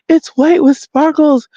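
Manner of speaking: high-pitched imitation of a young woman; upset young woman. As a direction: answer in her mimicked high voice. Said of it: "this is how she talks".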